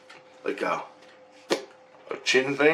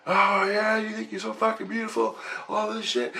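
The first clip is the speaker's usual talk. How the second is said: mocking yell; 12 semitones higher, 4 dB quieter than usual. Giant chained man taunting the dreamer, high mocking sneer. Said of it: "and he's yelling".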